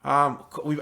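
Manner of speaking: usual speaking voice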